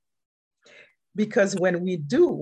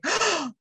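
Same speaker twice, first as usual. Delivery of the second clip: imitated gasp; 11 semitones higher than usual. Man learns it's her birthday.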